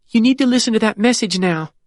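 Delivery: put-on high, worried child voice; a small girl asking her father for reassurance; anxious little girl. Annie insists.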